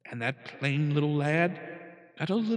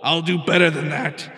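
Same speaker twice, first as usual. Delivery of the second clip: much higher and louder proclamation; thundering royal voice; 5 semitones higher, 10 dB louder than usual. A narrator's reading. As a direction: thunder it from the throne. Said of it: "his royal voice thundered".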